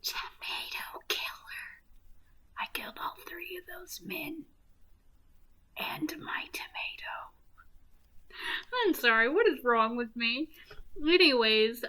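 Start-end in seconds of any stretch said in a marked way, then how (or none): 0.0-7.3 whispered confession. Acted. Joker confesses his killings on the show, whispering. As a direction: drop to a dramatic, unvoiced whisper.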